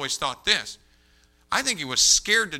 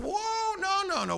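very high, drawn-out whoa; startled objection; slower than usual. Retold or imitated. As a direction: halt someone in a high voice. Acting his startled objection.

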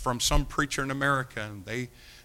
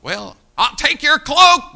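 much higher, louder, slow mock demand; mock taker; 13 semitones higher, 14 dB louder, slower than usual. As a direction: play the grabbing bully. Acted aggressor quote.

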